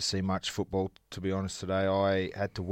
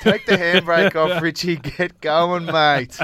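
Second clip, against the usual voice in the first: loud laughing tease; 7 semitones higher, 8 dB louder, slower than usual. Real, not acted. Teasing host to hurry.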